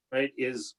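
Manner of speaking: ordinary delivery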